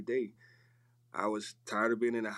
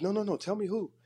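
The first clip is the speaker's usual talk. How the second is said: pitched up high and louder, pressing to know; insistent plea; 11 semitones higher, 4 dB louder than usual. Retold or imitated. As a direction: beg eagerly to be told. Girl insists on knowing the payer.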